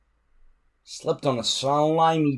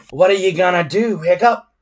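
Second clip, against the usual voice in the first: higher, louder jeering; sneering; 7 semitones higher, 8 dB louder than usual. A narrator's reading. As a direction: taunt the loser. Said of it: "sneered Snotlout".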